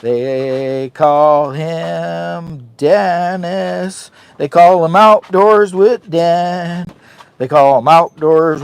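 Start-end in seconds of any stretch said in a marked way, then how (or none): 0.0-6.9 announcer intro. Acted. Dramatic intro of buddy, slow.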